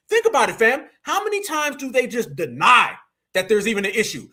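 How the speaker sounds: ordinary talk, nothing marked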